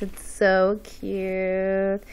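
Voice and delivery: soft, slow, tender coo; cooing softly. Real, not acted. Admiring the little blanket, a soft slow coo. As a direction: melt over how cute it is.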